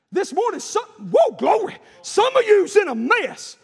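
high, loud revival exhortation; full-force preaching. Acted. Preacher confronting congregation about their mess, full-force preaching. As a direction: burst into fervent pulpit cries.

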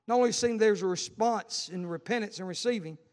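ordinary delivery

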